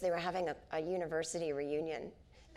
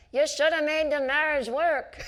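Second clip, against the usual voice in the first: higher, louder, slow mock heckler yell; 9 semitones higher, 8 dB louder than usual. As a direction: belt it out like an indignant heckler. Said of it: "this woman in the front row yelled out".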